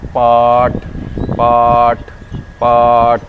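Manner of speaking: पाट loud, "paat" with no r; loud, separate model takes of पाट, long "aa"; long aa sound. Shown as a demonstration. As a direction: stretch the vowel in पाट. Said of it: "इसको बोलते हैं".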